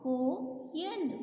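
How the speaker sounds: slow blended word. Shown as a demonstration. Teacher blending syllables for pupils, very slow. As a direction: say coil very slowly, joining the sounds. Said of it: "लो".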